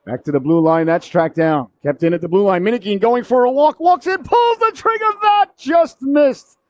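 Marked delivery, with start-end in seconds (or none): 2.6-6.6 soaring call, stretched on the near miss; building excitement. Real, not acted. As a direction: climb to a high cry as the attack unfolds. Live call of a solo attempt.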